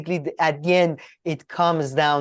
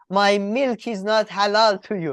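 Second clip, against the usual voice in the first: put-on Turkish accent; 6 semitones higher than usual. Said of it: "with a thick Turkish accent".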